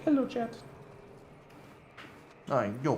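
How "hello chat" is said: higher, softer pretend dog voice; voicing the dog. Streamer voices his dog greeting chat, higher and soft. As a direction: speak for the dog like a puppet. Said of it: "Be like".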